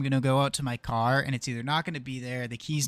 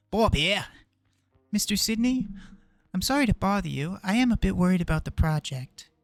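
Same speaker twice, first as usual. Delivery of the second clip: lightly put-on Australian accent, louder and higher; a nervous Australian producer on the phone; amateur Australian accent; 6 semitones higher, 6 dB louder than usual. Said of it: "I don't know why I went with Australian".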